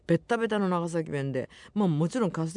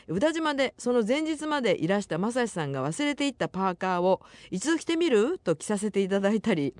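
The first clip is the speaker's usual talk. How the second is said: higher-pitched, lively radio letter reading; reading listener mail; 5 semitones higher than usual. Acted. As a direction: read the listener's message out brightly, pitched up. Reads listener's mail on air. (お便りを読み上げる高めの声)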